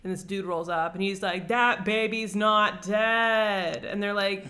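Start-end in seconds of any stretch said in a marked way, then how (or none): 1.5-3.8 slow, louder hero line, a bit hammed-up; lightly voiced hero. The Abhorsen declaring to the travelers, slow and loud. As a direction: drag it out like a grand claim.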